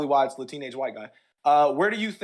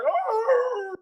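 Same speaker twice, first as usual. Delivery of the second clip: roar. Retold or imitated. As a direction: roar like a beast. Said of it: "he was like".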